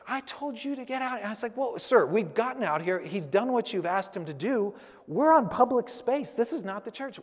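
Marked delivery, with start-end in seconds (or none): 0.1-1.2 much higher, a harsh different voice; retold barking guard. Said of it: "he just started barking". 1.6-7.2 loud, high, firm pushback; retold standoff. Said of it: "start yelling".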